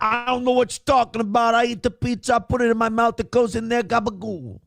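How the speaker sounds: high, dumb Italian-American guy; Italian-American accent. Retold or imitated. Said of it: "Dave Portnoy's like".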